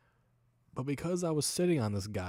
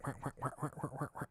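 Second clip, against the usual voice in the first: higher, softer string of wah sounds mimicking farts; walking fart noises; 5 semitones higher, 6 dB quieter, much faster than usual. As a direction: squeak out a string of little duck-like fart noises. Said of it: "It's like".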